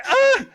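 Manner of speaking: short, very high mock scream; loud comic shriek of dismay; horrified scream. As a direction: yelp it in hammed-up horror. Acted scream at undying squirrel.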